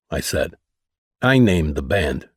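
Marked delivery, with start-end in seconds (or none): 1.2-2.3 low, cold and curt. A narrator's reading. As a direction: shut him down coldly. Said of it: "said Mel coldly".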